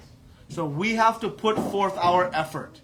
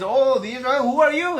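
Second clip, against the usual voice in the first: high, raised-voice challenge; challenging retort; 7 semitones higher, 6 dB louder than usual. Acted. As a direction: answer back like an unimpressed mother. Mother Yashoda answers bold Balram back.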